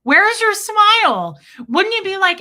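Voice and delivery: loud disbelieving rant. Real, not acted. Ranting at the clip.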